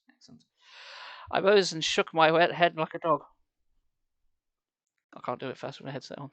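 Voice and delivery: ordinary delivery, nothing marked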